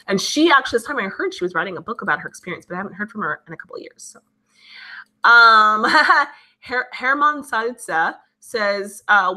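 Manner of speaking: ordinary delivery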